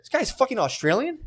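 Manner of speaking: high, incredulous what the fuck; shocked. Retold or imitated. Shocked quote about Mel Gibson.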